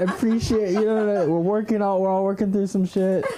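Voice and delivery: high, gushing, warm approval; imitated supportive crowd. Audience praising a comic's crazy set, gushing warmly. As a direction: cheer her on like a proud mom. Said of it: "you would be like".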